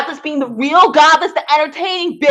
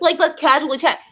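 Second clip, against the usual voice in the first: ordinary delivery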